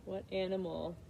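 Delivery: plain reading voice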